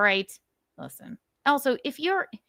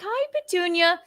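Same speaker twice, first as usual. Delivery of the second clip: cutesy high-pitched hello; high pet-talk greeting; 11 semitones higher than usual. Retold or imitated. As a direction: coo it as if to a cat. Acting out greeting the cat again.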